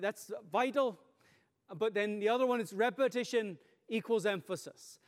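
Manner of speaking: ordinary talk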